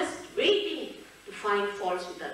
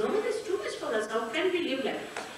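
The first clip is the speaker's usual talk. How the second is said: fast protest; 4 semitones higher, faster than usual. Retold or imitated. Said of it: "They said".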